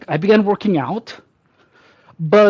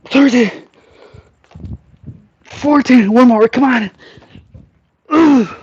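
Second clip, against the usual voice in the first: loud, higher, effortful counting; straining last reps; 6 semitones higher, 5 dB louder, slower than usual. Real, not acted. Pushing through the final reps of a set, straining. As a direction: grunt the final numbers out.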